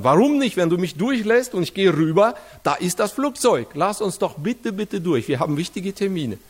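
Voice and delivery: high, urgent retold begging, rising at the start; desperate pleading. To the airport officer, begging to be let onto the plane, high urgent plea. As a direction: beg the official to let you through.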